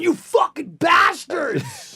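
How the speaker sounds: high, drawn-out retold yell; mock furious shout. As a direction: shout it slowly at the pranksters. Retells yelling at pranksters.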